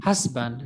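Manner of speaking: Husband as HUZ-bund, falling low; Husband with reduced second syllable; model first-syllable stress. Shown as a demonstration. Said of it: "Can you please repeat after this?".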